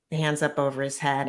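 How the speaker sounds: ordinary talk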